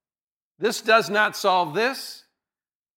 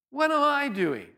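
higher, slow, emphatic self-question; What am I doing, asked higher and insistent; pressing question; 5 semitones higher than usual. Shown as a demonstration.

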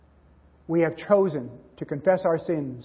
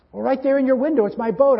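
put-on eager boy; 8 semitones higher, 4 dB louder than usual. Boy insists to pawnbroker.

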